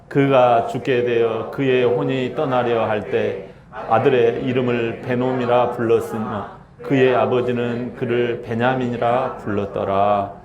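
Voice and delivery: low, slow, solemn Bible reading; scripture reading. Acted. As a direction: read the verse slowly and low like scripture. Reads scripture aloud. (낮고 느린 성경 봉독)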